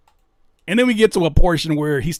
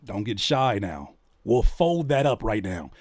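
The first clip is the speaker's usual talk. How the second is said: slow, low repeat; 6 semitones lower, slower than usual. Shown as a demonstration. Repeats lyric slowly.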